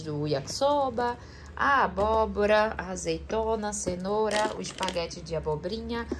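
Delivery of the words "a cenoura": normal talking voice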